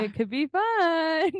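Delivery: high, slow coaxing; coaxing kid voice. Retold or imitated. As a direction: wheedle like a kid asking for dessert. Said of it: "babysitter convincing".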